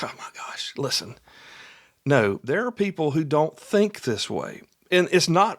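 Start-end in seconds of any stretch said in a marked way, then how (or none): 0.0-1.2 unvoiced whisper, fed up; whispered exasperation. Listener groaning at the host in a whisper. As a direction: breathe it out like an annoyed listener. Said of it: "I know you're like".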